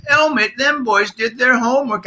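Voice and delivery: put-on high Birmingham accent, amused and approving. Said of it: "I'm gonna do a terrible accent".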